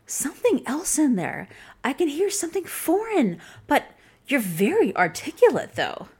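patronizing, sing-song praise; mocking quote. Woman doubting she's a native speaker, mock condescending.

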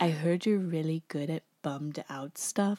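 soft, lightly imitated cartoon character; Sandy. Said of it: "Sandy approaches the conversation by saying".

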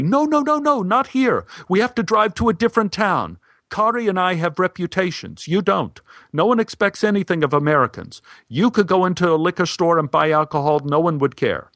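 high, insistent, urgent protest; emphatic correction. Acted. Stein and Kari correct the American, emphatic and high. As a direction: cut him off, correcting hard. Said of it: "corrected me emphatically".

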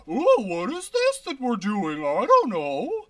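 a loud, high cartoon voice stretching out "don't know"; goofy second voice. Shown as a demonstration. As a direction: ham up a bewildered cartoon voice. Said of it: "My second voice is like".

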